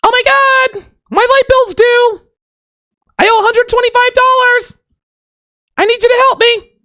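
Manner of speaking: put-on very high falsetto girlfriend in a panic; hammed-up pleading in a squeaky-high voice; mock girlfriend. Girlfriend calling up about her light bill, mock high-pitched pleading. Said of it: "Your girl calls you up and says".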